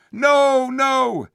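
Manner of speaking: loud high cry; crowd shouting. Acted. The crowd refusing the Tarkan, shouting. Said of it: "shouted most of the crowd".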